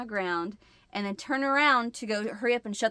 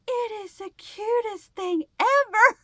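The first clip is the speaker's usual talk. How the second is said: high, loud delighted squeal; gushing; 11 semitones higher, 5 dB louder than usual. Retold or imitated. Wife to husband over the tiny bat, high gushing delight. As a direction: coo over it like a puppy.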